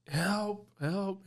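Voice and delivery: very high, desperate cries for help; calling for help. Retold or imitated. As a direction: cry out for help while your brother isn't breathing. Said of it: "I'm screaming for help".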